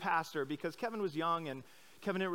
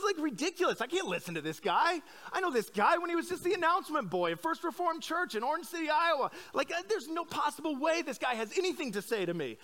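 higher, half-mocking outraged inner voice; jealous indignation; 9 semitones higher than usual. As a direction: act out your petty jealousy as an exaggerated rant. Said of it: "I think to myself".

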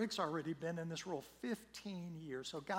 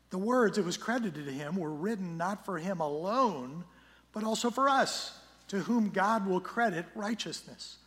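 louder, measured scripture reading from the pulpit; solemn public Bible reading; 6 dB louder than usual. Acted. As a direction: proclaim the passage with weight. Reads scripture passage aloud.

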